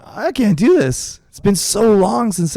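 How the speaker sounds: high, slow plea, imitating being overcome; retold choked-up. Instructor retells turning to his wife in tears, mock choked-up. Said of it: "I started crying. I couldn't talk".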